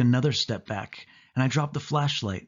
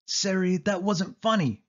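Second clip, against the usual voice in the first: higher, upset scolding cry; high-pitched shout; 8 semitones higher than usual. A narrator's reading. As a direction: yell at her, rattled and cross. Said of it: "shout in a high-pitched voice".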